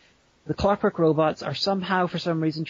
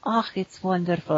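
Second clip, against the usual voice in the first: put-on higher-pitched refined Edinburgh accent; high-class Edinburgh; 3 semitones higher than usual. Podcaster mocking Edinburgh's high classness, put-on Edinburgh accent. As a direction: put on cultured Edinburgh airs. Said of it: "Edinburgh is all about culture and high classness".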